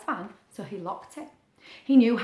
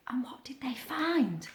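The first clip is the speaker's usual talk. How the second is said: whisper; 8 dB quieter than usual. A narrator's reading. Suspenseful question to children.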